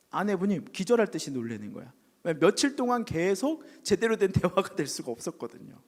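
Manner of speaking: ordinary talk, nothing marked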